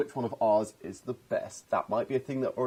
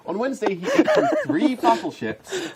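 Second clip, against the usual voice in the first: lilting picture-book narration; mock children's story, amused; storybook reading; 4 semitones higher, 3 dB louder than usual. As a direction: read it out like a children's bedtime book. Reads parody picture book aloud.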